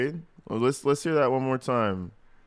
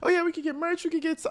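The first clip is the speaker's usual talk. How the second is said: high squeaky mock of Wade; much faster than usual. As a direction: squeak it out like a clueless dreamer. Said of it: "Wade is so stupid".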